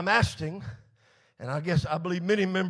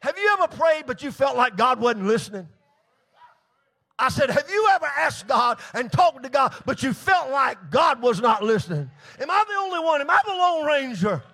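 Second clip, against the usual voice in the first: emphatic questions climbing higher; insistent preaching; 5 semitones higher, 6 dB louder than usual. Acted. Preacher asking who felt unheard by God, insistent preaching. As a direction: press the congregation, repeating with more force. Preacher presses congregation for response.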